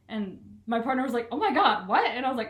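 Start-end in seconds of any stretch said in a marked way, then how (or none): 1.3-2.0 loud, high mock alarm; retold horrified disbelief, voice jumping up; imitated shock. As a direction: act out a startled partner. Retold partner's shocked reaction.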